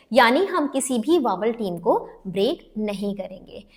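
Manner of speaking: usual speaking voice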